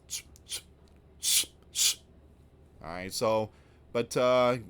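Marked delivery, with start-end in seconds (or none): 0.1-2.0 unvoiced shushing hiss; hushing shh. Telling Eric Adams to hush, shushing.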